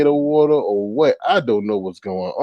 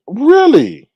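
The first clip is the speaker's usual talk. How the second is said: a loud, high, disbelieving really; incredulous really; 11 semitones higher, 8 dB louder than usual. Real, not acted. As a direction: sound stunned and outraged. Outraged disbelief at hypocrisy.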